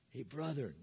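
"brethren" said softly and kindly; tender greeting. Shown as a demonstration. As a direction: draw them in with the word "brethren" gently. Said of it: "a very endearing term".

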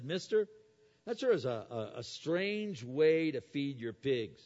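a hint of a small boy calling out; little boy. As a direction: pipe up like a curious little boy. Boy voiced in a story.

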